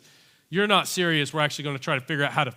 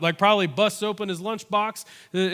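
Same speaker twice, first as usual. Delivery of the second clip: normal talking voice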